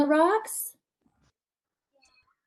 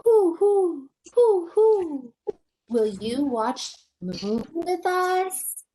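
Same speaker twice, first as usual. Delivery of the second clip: owl hooting; 5 semitones higher, slower than usual. Acted. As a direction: hoot like a wise owl. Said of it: "said Owl".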